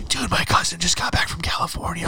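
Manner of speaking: imitating a classmate's forceful stage whisper; loud whisper. Kid behind you in English class, whispering. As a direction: whisper it urgently into someone's ear. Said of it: "try to whisper to you".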